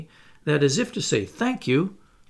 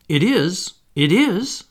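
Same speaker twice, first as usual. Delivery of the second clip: 1 eager cry; 5 semitones higher, 5 dB louder, slower than usual. Acted. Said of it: "they all cried".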